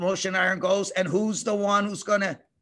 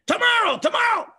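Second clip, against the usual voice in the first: high loud urgent reply; eager Pharaoh; 7 dB louder than usual. Acted. Pharaoh answering Moses when to end frogs, urgent. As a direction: blurt it out eagerly.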